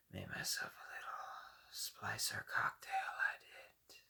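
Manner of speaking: hoarse, unvoiced creeping threat; menacing whisper. Frozen splicer threatening Cohen's messenger, menacing whisper. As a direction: hiss it close and cold.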